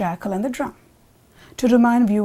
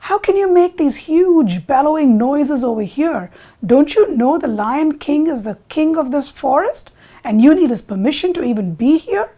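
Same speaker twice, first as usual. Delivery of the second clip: loud, higher-pitched indignant scolding; scolding jackal; 4 semitones higher, 6 dB louder than usual. Acted. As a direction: rebuke an intruder with forceful, emphatic stresses. Jackal scolds the frightened bull.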